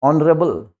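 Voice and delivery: ऑनरेबल with no H; silent H. Shown as a demonstration. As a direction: begin ऑनरेबल on the O vowel. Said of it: "हॉनरेबल नहीं". (ऑनरेबल, बिना एच के)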